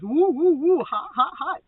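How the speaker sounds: high, loud whoops into quick exclamations; excited whooping. Acted. Reader acting out the soulmate's five D feeling, excited whooping. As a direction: whoop like it's too hot to handle.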